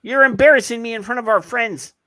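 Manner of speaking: high, loud play-tantrum; mock scolding. Host chides co-host before the audience, mock embarrassed. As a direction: wail it in mock outrage.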